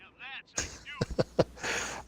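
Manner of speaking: high, breathy laugh; laughter. Real, not acted. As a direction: laugh high and airy, no words. Laughs after the sung line.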